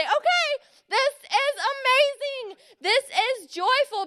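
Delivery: high, slow, exaggerated delight; put-on cheer, leaping up on okay; hammed-up forced joy. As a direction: talk yourself into being thrilled, overdoing it. Forcing joy at unwanted task.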